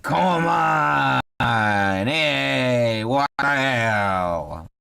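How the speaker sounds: long, drawn-out Boston wail; Boston halfling lament. Acted. Halfling finding his cat gone, drawn-out Bostonian cry. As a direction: wail it in a Boston accent, stretching the words. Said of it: "Yeah, he's from Boston".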